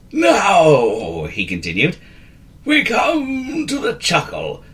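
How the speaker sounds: put-on a fussy old wizard correcting; wheezy wizard. A narrator's reading.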